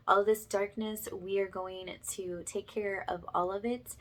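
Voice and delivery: usual speaking voice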